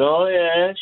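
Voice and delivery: high-pitched Southern Shore twang. Retold or imitated. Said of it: "in her Southern Shore twang".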